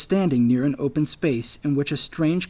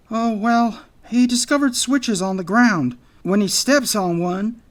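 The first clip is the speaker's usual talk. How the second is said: high, loud character voice; anxious anteater; 8 semitones higher, 5 dB louder than usual. A narrator's reading. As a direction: explain nervously, pitched well up. Anxious anteater explains to Jack.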